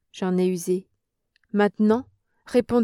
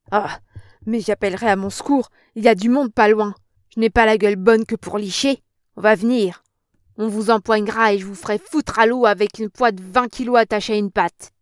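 threatening; 7 dB louder than usual. Acted. Old woman threatens to shout for help.

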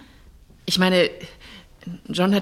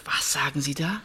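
shocked whisper; 4 semitones lower, 4 dB quieter than usual. Acted. Erschrockene Reaktion auf Polizeiverdacht.

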